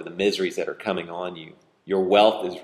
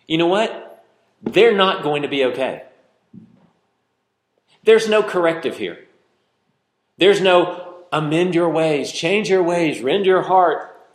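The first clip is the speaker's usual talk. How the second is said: high, loud pulpit thunder; preacher at full force, nearly shouting; fiery preaching; 9 semitones higher, 6 dB louder than usual. Acted. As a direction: hammer it home to the congregation.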